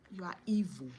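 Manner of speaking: ordinary talk, nothing marked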